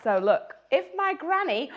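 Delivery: ordinary delivery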